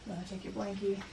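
very soft whisper; hush, speak barely aloud. Real, not acted. Whispering to sick child.

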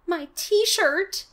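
high, loud cry of dismay; sudden squeal of realisation; hammed-up boyish panic; horrified exclamation. A narrator's reading. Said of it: "Casey exclaimed".

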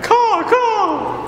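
high screeching crow caw; shrill crow caw. Acted. Crow calls in skit.

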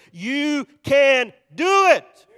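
very slow, loud, word-by-word shout; pep-rally shout. Real, not acted. Preacher holding a pep rally for church, rallying shout. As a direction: belt it out, stretching each word.

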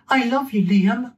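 put-on high, sweet little boy. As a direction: say it like a small child, high up. Child Dimitri voiced in story.

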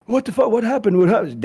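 high, startled what happened; imitating a panicked friend; alarmed. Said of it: "he's like".

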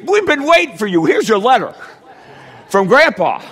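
high, loud, gushing welcome; overjoyed clerk, played big and high; mock delighted greeting. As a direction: greet a long-awaited visitor, hammed-up. Imagined post office greeting in mock delight.

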